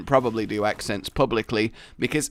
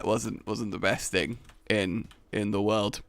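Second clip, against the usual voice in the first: ordinary talk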